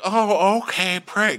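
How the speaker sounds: high, put-on agreeable voice; mock-nice Shep. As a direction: feign sweet agreement in a squeaky voice. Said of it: "he's trying to be nice to Taylor".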